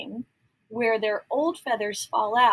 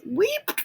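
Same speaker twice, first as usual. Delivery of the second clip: squeaky duck cry; 11 semitones higher, 4 dB louder than usual. Acted. Duck cry voiced in story.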